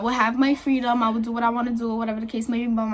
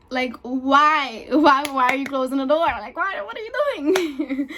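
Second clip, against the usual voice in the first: alarmed questioning; 2 semitones higher than usual. Retold or imitated. Patient reacting to doctor closing the door, mock alarm.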